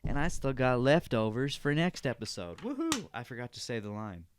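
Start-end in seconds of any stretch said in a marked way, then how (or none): 2.6-3.1 sarcastic cheer. Real, not acted.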